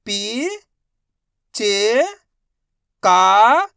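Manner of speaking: पी, च, का each held and separate; drawn-out letter drill on पी, च, का; sounding out letters. Shown as a demonstration. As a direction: call out पी, च and a loud का with pauses. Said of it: "एक एक अक्षर का उच्चारण करके".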